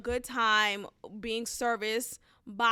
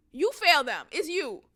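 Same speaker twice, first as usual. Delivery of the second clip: ordinary talk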